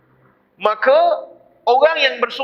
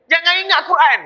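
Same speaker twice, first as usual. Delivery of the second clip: emphatic warning; 7 semitones higher than usual. Real, not acted. Preacher warning, repeated.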